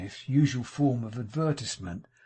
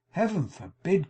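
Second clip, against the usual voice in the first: shocked cry; 7 semitones higher, 7 dB louder than usual. A narrator's reading. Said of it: "cried Missus Todgers".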